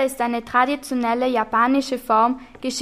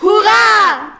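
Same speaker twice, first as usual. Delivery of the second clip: loud joyful cheer; 9 semitones higher, 9 dB louder than usual. Acted. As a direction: shout hooray with joy. Friends cheer victory.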